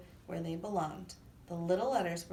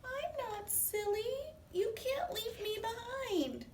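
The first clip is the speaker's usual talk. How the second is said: very high, slow, whiny squeak; tiny whiny voice; slower than usual. Acted. The lost dot crying out from hiding, tiny high whine. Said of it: "teeny, tiny, weeny, whiny voice".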